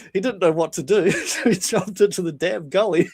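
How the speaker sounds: normal talking voice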